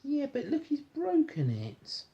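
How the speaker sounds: ordinary delivery, nothing marked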